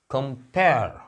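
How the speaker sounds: Compare as kum-PAIR, PAIR punched and falling; short weak kum, strong PAIR in compare; stress on second syllable. Shown as a demonstration. Teacher showing the comparison verb, compare stressed on PAIR. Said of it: "यहाँ stress है".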